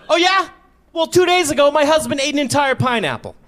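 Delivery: high, loud huffy comeback; hammed-up indignant retort; mock indignant woman. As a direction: snap back like an offended shopper. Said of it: "She was so mad at me".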